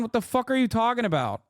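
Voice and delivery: ordinary talk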